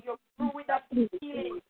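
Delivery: ordinary delivery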